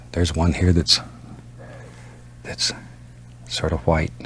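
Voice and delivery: usual speaking voice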